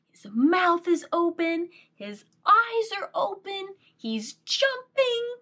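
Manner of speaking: high, excited storybook voice. Acted. Excited description to child.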